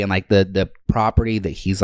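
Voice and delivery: usual speaking voice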